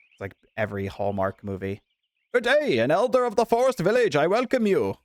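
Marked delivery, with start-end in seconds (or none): 2.3-5.0 formal elder greeting. Acted. Voicing game elder dialogue.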